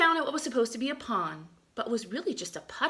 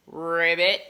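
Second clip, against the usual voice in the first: louder croaky ribbit; frog croak; 4 semitones lower, 8 dB louder than usual. Acted. Teacher showing how frogs say thank you, as a croaking ribbit.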